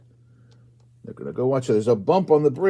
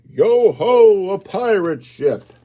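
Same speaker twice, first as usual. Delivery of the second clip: mock pirate call; 8 semitones higher, 9 dB louder, slower than usual. Pirate ship appears in story.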